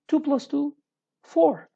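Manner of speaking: "two plus two" held high, pause, "four" high then falling; with intonation. Shown as a demonstration. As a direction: set "four" apart, high and loud. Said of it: "You can say it in this way".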